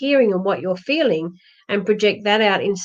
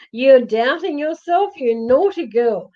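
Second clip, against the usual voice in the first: playful teasing; 6 semitones higher, slower than usual. Real, not acted. Teasing a viewer warmly.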